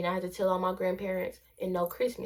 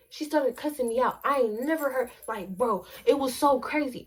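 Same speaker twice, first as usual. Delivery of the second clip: normal talking voice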